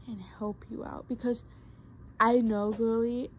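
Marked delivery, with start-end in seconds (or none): 0.1-1.1 a sudden whispered aside. Real, not acted. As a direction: drop into a whisper. Aside while lecturing viewers.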